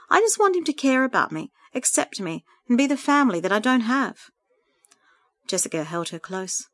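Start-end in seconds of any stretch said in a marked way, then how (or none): 0.1-4.3 higher voice, mildly tearful; tearful plea. A narrator's reading. Sandra longs for her brother to be family, gently tearful. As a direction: plead with understated hurt. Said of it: "she sobbed as the tears continued".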